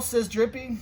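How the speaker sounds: ordinary delivery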